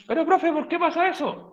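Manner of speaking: high-pitched mock student asking. Teacher voicing an imagined student asking why, high-pitched. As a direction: pitch it up like a curious pupil.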